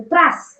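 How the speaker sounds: "त्रास" in one short burst; swift, fused tr in "त्रास"; quick joined reading. Shown as a demonstration. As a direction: read "त्रास" quickly, no gap between त and र. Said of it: "पटकन वाचायचं".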